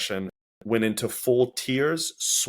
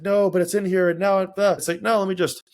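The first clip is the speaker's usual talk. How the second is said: much higher, louder flustered protest; worked-up panic; 9 semitones higher, 5 dB louder than usual. Retold or imitated. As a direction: act out losing composure in a rush. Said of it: "getting all worked up".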